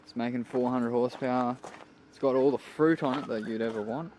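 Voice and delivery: ordinary delivery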